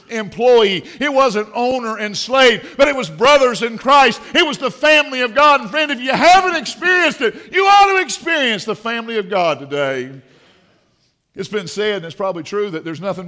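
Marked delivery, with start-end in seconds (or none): 0.1-10.2 loud, hammered pulpit phrases; forceful preaching. Real, not acted. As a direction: drive each phrase home like a preacher.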